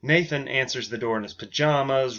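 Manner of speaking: usual speaking voice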